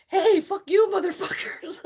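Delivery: high, loud cheery yell; loud mock hello with a curse; mock shouted greeting. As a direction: belt it out like a rowdy streamer intro. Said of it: "when they go".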